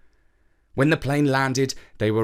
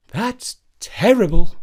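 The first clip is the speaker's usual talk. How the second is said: Mum commenting; 6 semitones higher, 8 dB louder than usual. A narrator's reading. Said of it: "Mum commented".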